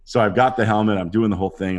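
ordinary talk

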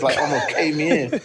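ordinary delivery

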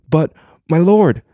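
high and loud, cut off mid-plea; panicked plea. Acted. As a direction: cry out to the lord in alarm. Dragon pleads before vanishing.

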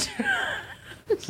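a real laugh that shoots up high; high burst laugh. Real, not acted. Friend cracking up at the pillow joke, high burst of laughter.

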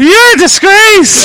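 high, loud, slow mock scolding; mock yell. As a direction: bellow it in mock outrage. Mock echo of earlier insult.

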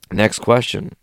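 usual speaking voice

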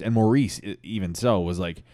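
usual speaking voice

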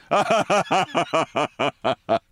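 a real high laugh at his own joke. Real, not acted. Laughing at his own story, a real laugh. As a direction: let a laugh roll out after the punchline.

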